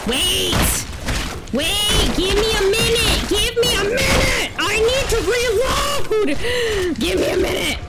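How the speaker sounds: desperate yell, pitch way up; panicked shouting. Real, not acted. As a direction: shout for them to hold off while you reload. Panicked while enemies attack.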